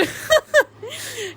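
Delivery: high, squealy laugh; giggling fit. Real, not acted. Laughs about ex-boyfriend's gift.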